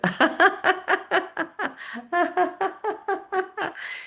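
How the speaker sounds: long, high forced laughter; deliberate high cackling laugh; high exercise laugh. Shown as a demonstration. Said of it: "all of this is gonna happen with laughing".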